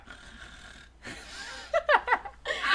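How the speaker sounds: breathy snort of laughter. Real, not acted. Snort laugh after line.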